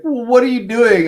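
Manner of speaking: high, alarmed; indignant doctor voice; shocked doctors. Retold or imitated. Doctors react to mom's ginger, high alarmed question.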